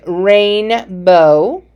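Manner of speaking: "rainbow" slowly in two parts, rain... bow; split into syllables. Shown as a demonstration. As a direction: draw out and separate both halves of "rainbow". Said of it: "So it's gonna say".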